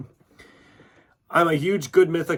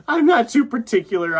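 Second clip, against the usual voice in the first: said through a real laugh, higher and louder; 9 semitones higher, 7 dB louder than usual. Real, not acted. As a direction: laugh it out, amused at yourself. Laughing at own answer.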